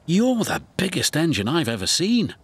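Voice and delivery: excited cry. Acted. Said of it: "cried Thomas".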